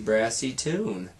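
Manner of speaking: ordinary delivery, nothing marked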